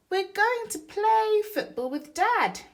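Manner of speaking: put-on loud, very high child shout; excited yell. Boy bursting in to tell Mum about football, loud high yell. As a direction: burst in yelling with glee. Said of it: "yelled Lester".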